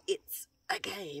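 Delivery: high, slow, hushed eerie echo; creepy ghost voice. Retold or imitated. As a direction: mimic the creepy spirit voice. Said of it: "Oh, it was so creepy".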